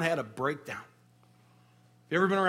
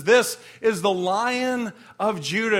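louder, slow title proclamation; proclaiming; 3 semitones higher, 6 dB louder, slower than usual. Acted. The elder naming the Lion of Judah, proclaiming. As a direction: announce the title with weight.

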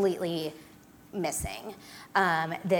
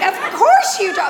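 mock indignant retort; 10 semitones higher, 10 dB louder than usual. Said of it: "And I'm like".

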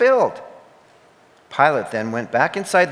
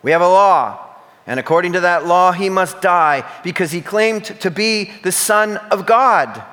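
loud, high and pressing, a bit fast at first; insisting; 6 semitones higher, 6 dB louder than usual. A narrator's reading. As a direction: push every word at him.

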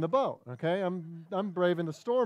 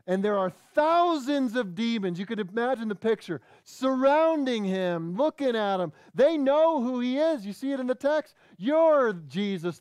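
high, loud preacher's delivery; forceful preaching; 7 semitones higher, 5 dB louder than usual. Real, not acted. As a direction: build the sermon with full force, punching key phrases.

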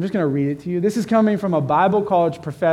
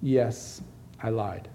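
much softer, sheepish admission; soft confession; 4 semitones lower, 8 dB quieter, much slower than usual. Retold or imitated. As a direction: own up quietly, head down. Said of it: "I replied softly".

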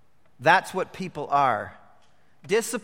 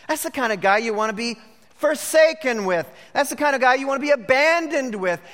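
louder, driving refrain; preaching; 8 semitones higher, 6 dB louder than usual. Acted. Preacher drawing the lesson from Edison, louder driving preaching. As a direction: hammer each repeated line home.

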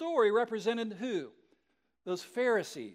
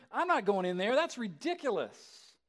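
usual speaking voice

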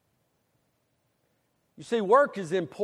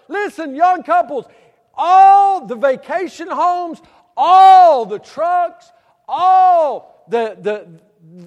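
high, very loud and slow, dragging out "all" and "ever"; climactic preaching; 9 semitones higher, 14 dB louder, slower than usual. Acted. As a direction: hammer it home to the young couples.

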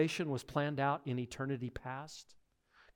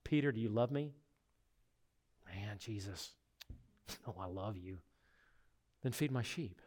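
much softer, intimate exchange; hushed dialogue; 5 semitones lower, 9 dB quieter than usual. Retold or imitated. Jesus questioning Peter on the shore, soft and hushed. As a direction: speak it gently, almost under the breath. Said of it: "he's basically saying".

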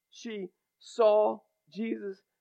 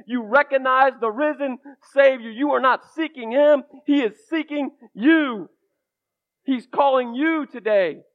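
loud, higher, driving sermon climax; full-force preaching; 5 semitones higher, 6 dB louder than usual. Acted. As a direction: preach it hard to the congregation.

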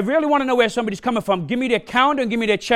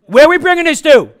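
much higher, louder call to the crowd; shouted question; 6 semitones higher, 9 dB louder than usual. Shown as a demonstration. Preacher calling out to the congregation, shouting. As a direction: shout it out to get the answer. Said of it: "preacher drills congregation on the answer".

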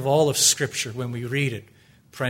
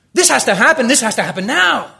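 urgent demand; 10 semitones higher, 10 dB louder, faster than usual. Pretend. Preacher voicing his own urgent prayer.

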